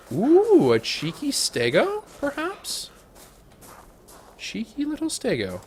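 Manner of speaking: high, delighted cooing at a cheeky Stego; high excited coo at the dinosaur; cooing excitement. Real, not acted. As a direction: coo over it like a cute pet. Spotting a dino, cooing.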